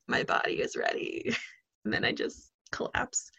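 low, breathy whisper, worn out; tired whisper. Retold or imitated. Worn out after work, seeing the bed, low exhausted whisper. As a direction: sigh it out like you're about to flop into bed. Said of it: "had a long day at work".

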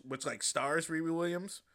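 usual speaking voice

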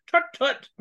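high, loud English tut-tut; mock-British tut, tut; posh English tut. English guy voiced.

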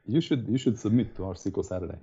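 ordinary delivery

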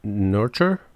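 "Nurture" with the R, NOR-cher, rising; corrected pronunciation. Shown as a demonstration. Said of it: "should I have said a different word?".

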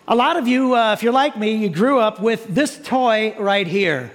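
ordinary talk

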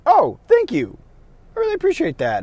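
high, loud mock gratitude; grateful player. Acts out grateful players being corrected.

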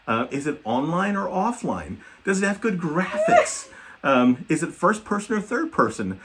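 a hint of a curious literal kid, questions tumbling out; eager kid questions. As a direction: son grilling his dad about games, building excitement. Son's barrage of game questions.

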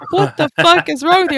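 high, loud mock disbelief; hammed-up outrage; incredulous brother. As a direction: play the baffled brother. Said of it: "He's like".

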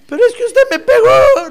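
put-on high, laughing child's complaint; whiny child voice. (niño quejándose al papá, voz aguda y risueña)

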